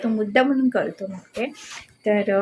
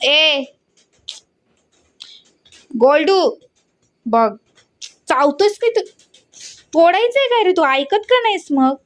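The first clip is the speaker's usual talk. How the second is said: scolding the dog; 6 semitones higher than usual. Real, not acted. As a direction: scold a naughty dog who won't listen. Owner scolds dog chewing things.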